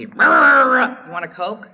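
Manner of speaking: loud, slow, drawn-out offer of a Coke; dad's sudden calm. Retold or imitated. Father after his fit, slow loud casual offer. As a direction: play the father as if the fit never happened.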